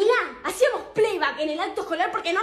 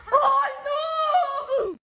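horrified cry; 10 semitones higher than usual. Acted. Friend's horrified reaction.

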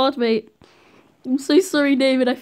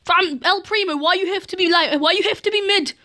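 higher, whiny disbelief; exasperated complaint; 7 semitones higher, 4 dB louder than usual. Real, not acted. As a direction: complain to El Primo why he is so bad. Exasperated at teammate El Primo.

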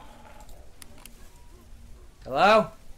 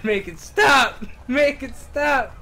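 laughing plea; 7 semitones higher, 5 dB louder, slower than usual. Real, not acted. Pleading at scary game.

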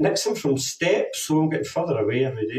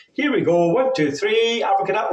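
magic word call; 6 semitones higher than usual. Acted. Kids chant the magic word.

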